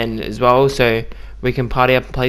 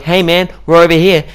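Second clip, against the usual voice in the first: higher, louder mock teammate call; imitated calling out; 6 semitones higher, 6 dB louder than usual. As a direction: call lightly across to a teammate. Imitates teammate calling across map.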